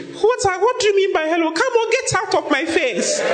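put-on a snooty girl waving off a greeting; haughty girl. Girl scorning a shy man's hello, high mock-haughty voice. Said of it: "If I say hello, she say".

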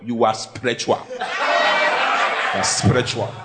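low, drawn-out mock amazement; awestruck husband. A husband marvels at his wife, hammed-up awe. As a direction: marvel deeply, stretching the last words.

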